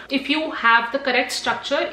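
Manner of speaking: ordinary talk, nothing marked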